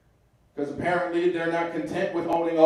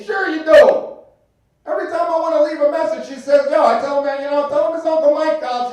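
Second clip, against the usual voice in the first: Uncle Mike voice; 10 semitones higher, 6 dB louder than usual. Retold or imitated. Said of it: "Uncle Mike quote".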